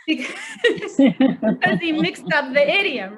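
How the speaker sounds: a real laugh, pitch jumping up. Real, not acted. Teacher laughing at the student's mixed-up idiom. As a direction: laugh breaking into the word.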